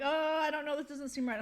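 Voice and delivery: a touch of panic in a taxi; mildly panicked. Retold or imitated. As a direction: fret as if lost. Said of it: "mildly panicked".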